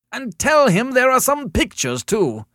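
loud, high, overwrought caller; agitated complaint. A narrator's reading. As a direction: pile on the grievance at high pitch. Hysterical caller adds another grievance.

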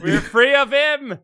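high, slow triumphant cry; gleeful cheer. Real, not acted. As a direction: savour the victory out loud. Cheering dad's removal.

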